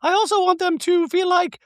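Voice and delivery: very high-pitched mock-chirpy caricature; ditzy reality-star send-up, far above normal pitch; Dorit. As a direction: squeal it out in a silly falsetto. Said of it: "Dorit means".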